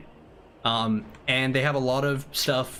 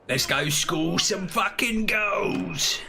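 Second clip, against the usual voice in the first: high, slow hooligan shout; football hooligan; 4 semitones higher, much slower than usual. Pretend. Ogres likened to hooligans off to score goals, mock shout. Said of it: "They remind him of football hooligans".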